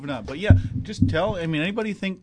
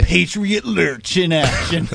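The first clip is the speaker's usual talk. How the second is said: announcer voice; 3 semitones higher, 6 dB louder, slower than usual. Pretend. Announcing friend mockingly.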